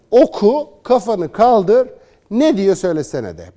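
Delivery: loud, high, slow demand to look up and say it; taunting command. Pretend. Commentator taunting critics over the speech, loud high commands. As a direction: push them to recite, loud and high.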